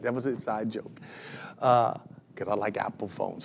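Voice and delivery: dropped way down, half under his breath; quiet aside. Real, not acted. Preacher confessing an inside joke to the congregation, quiet aside. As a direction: toss it off softly to yourself.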